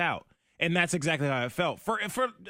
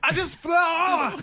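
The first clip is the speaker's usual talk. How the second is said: strained high exclamation; 14 semitones higher, 6 dB louder, much slower than usual. Real, not acted. Clip replayed, burning reaction.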